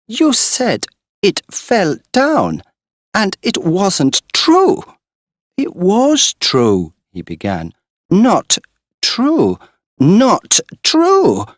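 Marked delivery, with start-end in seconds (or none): 0.1-6.9 high, loud, slow accusation; accusing cry. Acted. Girl to Morris, slow loud accusing cry. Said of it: "she cried". 8.1-11.5 high, loud protest; angry cry. Acted. Girl denying Morris, crying out angrily. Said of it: "she cried".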